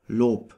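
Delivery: Lob ending in a P sound; final B as P. Shown as a demonstration. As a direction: say Lob with the B hardened to P. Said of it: "it is pronounced like a P".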